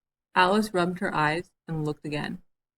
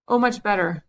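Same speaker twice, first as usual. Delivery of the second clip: louder glad cry; a louder relieved cry; cried out; 4 semitones higher, 6 dB louder than usual. A narrator's reading. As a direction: call out that it's better. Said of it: "cried the Queen".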